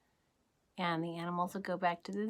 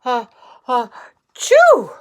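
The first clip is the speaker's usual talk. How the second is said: big cartoon sneeze for the kids; acted sneeze; 3 semitones higher, 16 dB louder than usual. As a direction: wind up and explode into a sneeze. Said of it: "Amos awoke with a sneeze".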